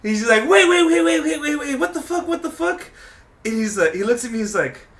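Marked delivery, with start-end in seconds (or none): 0.5-2.9 rapid high-pitched alarmed protest, retold; high, incredulous stammer of objection, acted out; mock disbelief. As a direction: imitate a friend halting everything in disbelief. Friend's alarmed interruption, acted out.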